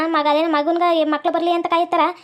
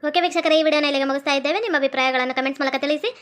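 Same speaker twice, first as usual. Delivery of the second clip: lower, softer host sign-off; channel outro; 4 semitones lower, 3 dB quieter than usual. Shown as a demonstration. Host signing off to viewers, asking them to like and subscribe. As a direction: wrap up the video for viewers.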